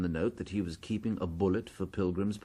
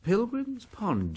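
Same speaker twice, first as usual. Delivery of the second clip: startled; 14 semitones higher, slower than usual. Acted. Startled by the note's place name.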